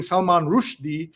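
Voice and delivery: RUSHDIE as ROOSH-dee; corrected pronunciation. Shown as a demonstration. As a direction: say Rushdie with an oo vowel. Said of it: "you say".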